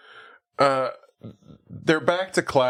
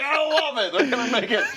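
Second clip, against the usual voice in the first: high-pitched, over-thrilled mock writer; mock excitement; 11 semitones higher than usual. Comedy writers greenlight a bad sketch, mock excitement. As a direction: gush at a silly pitch, way up high.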